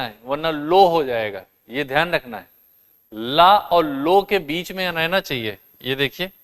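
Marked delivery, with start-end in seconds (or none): none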